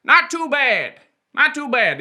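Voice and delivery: "Not too bad" pushed high out the right nostril; right-nostril demo. Shown as a demonstration. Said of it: "you say it at the right nostril".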